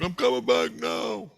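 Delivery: the Christ calling from the moon, high and slow; the Christ calling back. Retold or imitated. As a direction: stretch it out slowly, high and far away. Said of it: "He's like".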